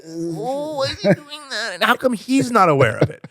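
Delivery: very high, strangled mock dismay; cringing. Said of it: "I feel like I'm sinking into my body".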